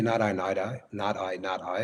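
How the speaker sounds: ordinary talk, nothing marked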